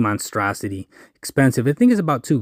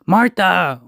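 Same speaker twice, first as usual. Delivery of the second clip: calling out a name, raised pitch; mock yell; 6 semitones higher than usual. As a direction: imitate calling a customer for pickup. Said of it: "instead of yelling like".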